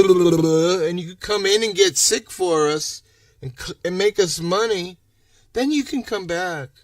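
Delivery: high, whiny sneer at the clinic; sarcastic imitation of the doctor's office; mocking office voice. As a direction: parody the office's excuse, nasal sneer.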